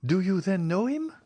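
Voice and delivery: voiced high as Julie, hopeful; eager young woman. Julie asks the stranger if he knows him, eager young voice. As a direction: ask it with sparkling hope.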